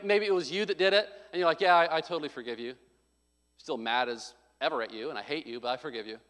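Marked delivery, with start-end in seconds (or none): 1.6-6.2 mock forgiveness sinking into a low grumble; insincere forgiveness. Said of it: "but not really".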